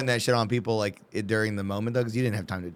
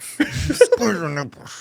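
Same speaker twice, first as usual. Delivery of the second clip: slow, high, drawn-out command; hammed bedroom command; 8 semitones higher, 5 dB louder, much slower than usual. Mocking a lover's request, slow high command. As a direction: stretch every word, camping it up.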